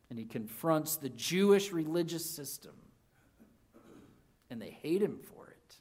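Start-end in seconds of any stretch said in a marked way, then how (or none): 4.5-5.8 a hushed whisper of menace. Real, not acted. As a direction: let the words sink into a whisper. Dark turn in the story.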